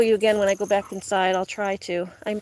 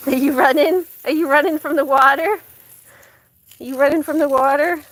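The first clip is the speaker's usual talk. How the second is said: higher, louder teasing to the dog; teasing pet talk; 4 semitones higher, 6 dB louder than usual. Real, not acted. Teasing the dog running from water, higher playful pet talk. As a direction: laughingly tease the pup.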